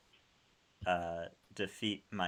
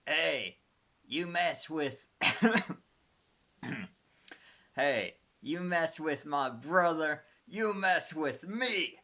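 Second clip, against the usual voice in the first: put-on higher New York tough guy accent; 7 semitones higher, 3 dB louder than usual. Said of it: "trying to do a New York accent".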